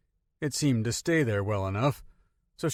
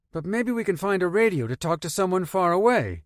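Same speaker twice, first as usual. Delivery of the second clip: put-on higher, lighter young girl voice; girl character voice; 8 semitones higher, 4 dB louder than usual. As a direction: voice the girl brightly.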